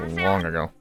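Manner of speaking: ordinary delivery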